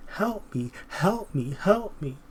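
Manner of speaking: high pleading cry for help; begging voice. Retold or imitated. Mocking the desperate begging the government for help. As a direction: whine it like a desperate beggar.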